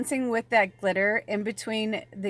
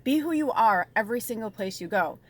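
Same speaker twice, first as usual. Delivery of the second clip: ordinary delivery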